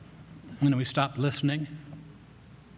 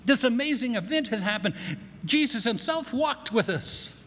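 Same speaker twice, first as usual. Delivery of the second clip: high, bursting with the news; high excited retelling of the walk; excited Cleopas; 8 semitones higher than usual. Retold or imitated. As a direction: tell them the amazing news breathlessly. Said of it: "and he's telling them".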